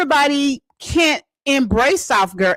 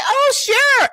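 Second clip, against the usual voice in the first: eager people pleaser. Retold or imitated. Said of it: "And I was like".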